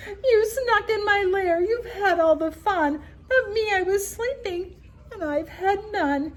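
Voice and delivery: high, loud whiny sob of a left-out bear; whimpering bear.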